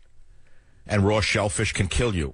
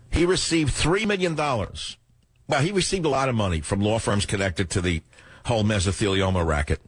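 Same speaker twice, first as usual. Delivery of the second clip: ordinary talk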